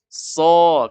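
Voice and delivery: loud, long SO without a pout; thick unpouted sod. Shown as a demonstration. Teacher corrects the lips, thick so. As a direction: hold "So" heavy, lips relaxed. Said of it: "bibir ini tidak monyong".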